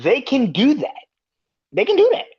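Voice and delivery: loud, high, rising insistence; emphatic insist. Real, not acted. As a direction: build to a near shout.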